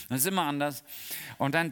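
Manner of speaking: normal talking voice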